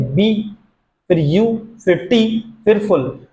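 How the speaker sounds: "बी", "यू", "टी", "फुल" as four separate syllables. Shown as a demonstration. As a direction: break the word into "बी", "यू", "टी", "फुल" with gaps. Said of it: "चार बार इसमें वावेल sound change हुआ".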